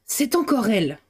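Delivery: hostile announcement. A narrator's reading. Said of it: "d'un air d'hostilité".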